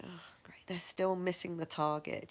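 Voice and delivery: flat, whispered sarcasm; a whispered, deadpan sarcastic aside. Retold or imitated. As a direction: mutter it sarcastically under your breath. Retold sarcastic reaction.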